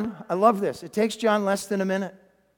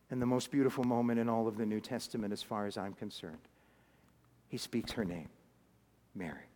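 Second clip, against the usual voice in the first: much softer and lower, sinking to a whisper; hushed reverent; 10 semitones lower, 12 dB quieter than usual. Acted. Preacher tells the congregation Jesus speaks her name, hushed. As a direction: let it fall to a reverent hush. Preacher reaches the most beautiful moment.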